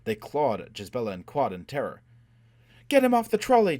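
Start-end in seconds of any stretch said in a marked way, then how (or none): 2.9-3.8 very high, loud yell; yelled order. A narrator's reading. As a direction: yell the command urgently. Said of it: "Gisbella yelled".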